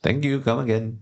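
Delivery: put-on a light shopkeeper Indian accent. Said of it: "Do the Indian accent?".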